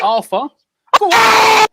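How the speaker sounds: high, loud cheering shout; excited shout. Real, not acted. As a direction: roar him on toward goal. Cheering attack toward goal.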